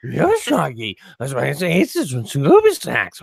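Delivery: slurred, put-on talking-dog voice; Scooby-Doo impression. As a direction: talk like a goofy cartoon dog.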